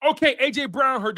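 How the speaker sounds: normal talking voice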